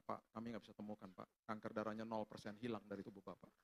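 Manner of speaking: low, very soft delivery; hushed retold report. Doctor telling patient the cancer is gone, hushed. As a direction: let the news land almost under the breath.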